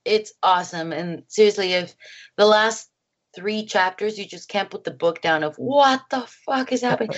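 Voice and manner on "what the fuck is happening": shocked disbelief. Acted. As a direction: act out a stunned reader's outburst. Imagined reader reacting in shock.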